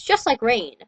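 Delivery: ordinary delivery, nothing marked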